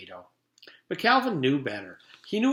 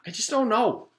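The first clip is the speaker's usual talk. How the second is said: exasperated; 10 semitones higher, 3 dB louder than usual. Acted. Calvin repeating in frustration.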